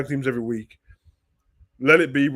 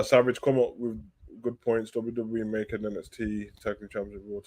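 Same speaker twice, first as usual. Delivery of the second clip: usual speaking voice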